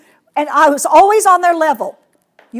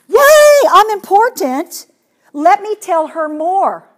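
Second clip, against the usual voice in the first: put-on thrilled child; 3 semitones higher, 5 dB louder, slower than usual. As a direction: act a child delighted to be heard. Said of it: "the child would go".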